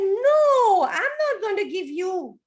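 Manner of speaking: high laughing no; high giggly no; laughing refusal. Acted.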